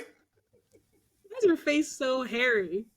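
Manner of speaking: laughing. Real, not acted. Joking quote, laughing through it.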